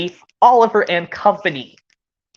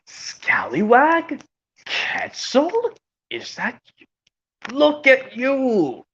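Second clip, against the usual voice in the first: cartoon reunion; slower than usual. Old friends Scallywag and Quetzal meeting again, amazed. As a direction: act out astonished recognition, slowly. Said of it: "And also like this".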